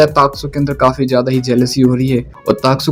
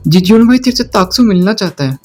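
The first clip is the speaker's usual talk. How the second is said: higher, sly messenger voice; henchman's message; 6 semitones higher than usual. Acted. Two boys in the rain summon Jijon for Taksu, higher sly voice. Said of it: "दो लोग आके कहते हैं".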